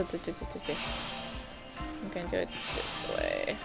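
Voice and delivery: normal talking voice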